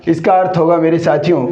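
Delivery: usual speaking voice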